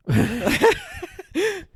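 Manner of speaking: loud, high cackle at himself; real laugh at his own story; high burst of laughter. Real, not acted.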